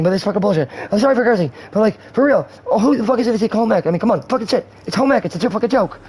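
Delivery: high, agitated comic rant; high-pitched outraged impression; Seth from Superbad. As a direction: rant high and worked-up like the movie character. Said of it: "I sound like Seth, um, from Superbad".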